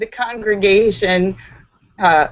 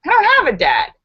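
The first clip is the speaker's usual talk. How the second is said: sudden realization; 8 semitones higher, 5 dB louder, faster than usual. Acted. Joke character realizes he has no dad.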